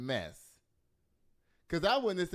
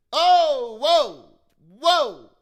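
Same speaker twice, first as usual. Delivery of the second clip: loud, high startled exclamation; shocked whoa; 8 semitones higher, 15 dB louder, much slower than usual. Real, not acted. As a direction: blurt out loud surprise. Startled reaction to the news.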